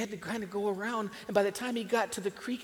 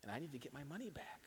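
hushed; 14 dB quieter than usual. A narrator's reading. Sad exchange over the price.

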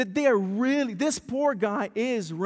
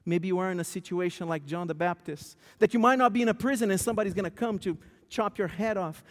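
ordinary talk, nothing marked

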